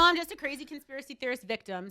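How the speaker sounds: loud, high sarcastic jab; mock herself bitterly, out loud. Real, not acted. Bitter joke about being arrested.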